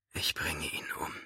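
strained, tight whisper; pressed whisper. A narrator's reading. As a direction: squeeze the words out in a whisper. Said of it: "eine gepresste Stimme".

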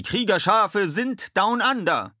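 slow, high, loud shout; drawn-out high call; rallying cry. A narrator's reading. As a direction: proclaim your arrival to the whole beach. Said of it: "riefen sie".

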